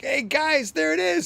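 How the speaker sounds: high, excited shout to friends; high-pitched excited call. Retold or imitated. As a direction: call out the find, thrilled. Calling out on finding key.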